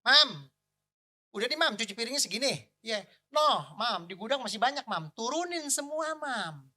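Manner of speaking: loud, playful calling out; mock-demanding shout from the sink; joking call to wife. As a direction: holler jokingly across the house. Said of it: "Saya cuma bercanda aja kalau cuci piring gini".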